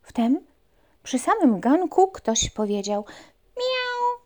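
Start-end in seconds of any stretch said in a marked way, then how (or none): 3.6-4.2 very high cat meow. Acted. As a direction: mew like a little cat. Unseen meow.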